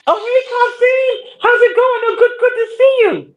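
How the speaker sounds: a gushing manager greeting her regular; imitating Susan.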